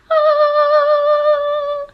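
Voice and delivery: a soaring angelic Ah; angelic choir note. Pretend. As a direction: sing it like an angel chorus. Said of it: "all angelic".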